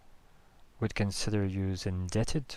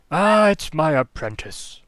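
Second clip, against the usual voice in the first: put-on loud, higher, slow old-man voice; drawn-out befuddled old mage greeting; doddering old wizard; 7 semitones higher, 12 dB louder, slower than usual. High Enchanter noticing First Page, loud slow old-man greeting.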